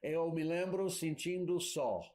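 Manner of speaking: ordinary talk, nothing marked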